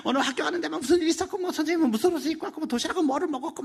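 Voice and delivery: high, fast excited babble; breathless chatter. Retold or imitated. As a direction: rattle it off like a thrilled schoolgirl. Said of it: "숨 넘어가면서 막". (학교 얘기를 숨가쁘게 쏟아내는 딸)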